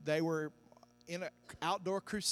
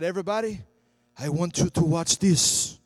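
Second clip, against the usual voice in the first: Reinhard Bonnke impression; 10 dB louder than usual. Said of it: "That's how he talks".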